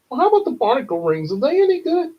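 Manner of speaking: fast and high, eager; fast high cartoon customer. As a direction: rush it out brightly like a fussy customer. Customer asks in episode.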